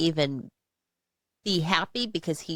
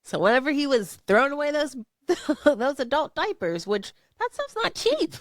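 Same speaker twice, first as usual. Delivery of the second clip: laughing disbelief; 9 semitones higher than usual. Real, not acted. Laughing about expensive diapers.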